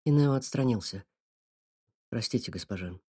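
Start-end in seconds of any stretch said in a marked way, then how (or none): 2.1-3.0 soft, low and gentle. Acted. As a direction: let her down as softly as you can. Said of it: "сказал он как можно мягче".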